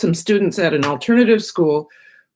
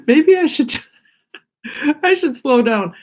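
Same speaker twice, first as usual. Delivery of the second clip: laughing; 8 semitones higher, 3 dB louder than usual. Real, not acted. Laughing at her own rambling.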